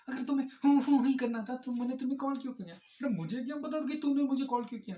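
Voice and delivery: high, loud mock exasperation; acted exasperated quarrel. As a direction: throw it back like a fed-up lover. Acts couple quarrel over call. (झुँझलाहट भरी बहस का अभिनय)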